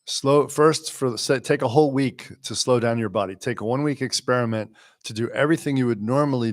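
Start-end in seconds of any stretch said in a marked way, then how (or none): none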